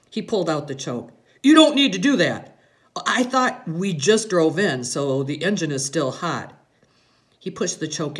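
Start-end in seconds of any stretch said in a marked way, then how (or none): 1.4-6.5 loud correction, settling as it goes; lightly voiced father teaching his son; instructing father. A narrator's reading. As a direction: cut in loudly, then explain more evenly. Father corrects son's driving.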